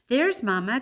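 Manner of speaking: excited little cry. Acted. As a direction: call out to mama in joy. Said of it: "Kudzu cries".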